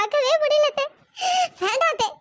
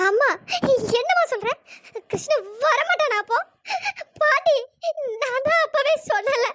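normal talking voice

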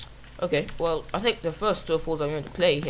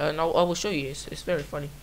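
usual speaking voice

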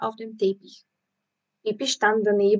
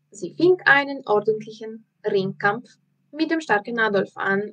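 plain reading voice

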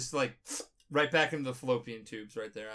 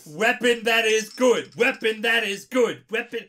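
dumb robot voice; 6 semitones higher, 10 dB louder than usual. Shown as a demonstration. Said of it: "Is that better?".

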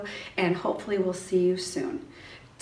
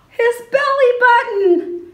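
much higher and louder, gasped delight; excited reveal; 13 dB louder, slower than usual. Acted. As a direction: burst out with the happy surprise. Big reveal to preschoolers.

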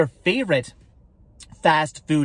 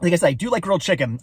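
ordinary talk, nothing marked

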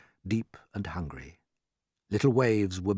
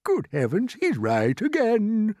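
put-on high old grasshopper, drawn-out ending; delighted cry; 13 semitones higher, 6 dB louder, slower than usual. A narrator's reading. Grasshopper claps at James's reasoning, crying out. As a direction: exclaim it in stately amazement. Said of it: "cried the old green grasshopper".

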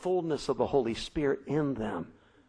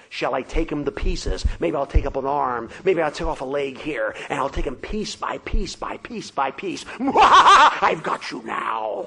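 louder gloating, ending in a slow laughing shout; gloating giant; 9 dB louder than usual. Acted. The giant gloating over trapped David, laughing loudly. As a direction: savour each threat, then laugh in triumph.

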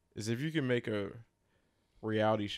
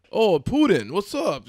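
hammy fake Russian accent, loud; a deliberately terrible Russian accent; bad Russian accent; 11 semitones higher, 14 dB louder, slower than usual. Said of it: "put on a bad Russian accent".

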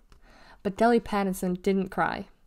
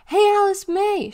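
high, loud call across the playground; loud, high-pitched shout; holler; 11 semitones higher, 7 dB louder than usual. A narrator's reading. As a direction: holler it out to a friend. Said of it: "she hollered".

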